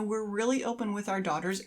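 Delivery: ordinary talk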